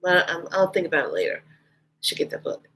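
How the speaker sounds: very soft inner-thought voice, sinking to a whisper; imitating silent musing, barely voiced; thinking to herself. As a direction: murmur it as a private thought. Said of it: "I didn't say it out loud".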